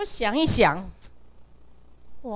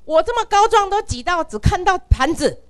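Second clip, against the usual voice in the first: ordinary delivery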